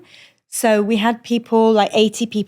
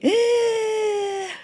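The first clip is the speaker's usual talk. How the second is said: high drowning gasp; gasp for air; 12 semitones higher, 4 dB quieter than usual. Retold or imitated. Feeling like she's underwater, gasping. Can't reach the surface.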